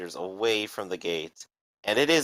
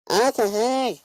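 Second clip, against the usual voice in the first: put-on high, muffled drunk voice through a door; 12 semitones higher, 5 dB louder than usual. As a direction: call it out from behind a door. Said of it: "a muffled voice from the other side go".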